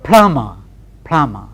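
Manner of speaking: "plumber" as plum-a; "plumber" spoken without the b; silent b plumber. Shown as a demonstration. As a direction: say "plumber", b dropped. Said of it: "P L U M B E R".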